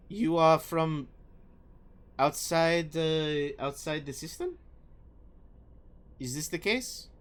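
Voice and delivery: put-on French accent. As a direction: question haltingly with a French accent. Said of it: "when I heard, like, the French accent".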